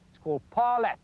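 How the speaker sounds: ordinary talk, nothing marked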